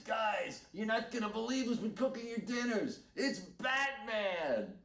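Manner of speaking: high excited shout to the dining hall, a stretched-out ending. Retold or imitated. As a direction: announce it to the whole hall. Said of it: "you hear him go".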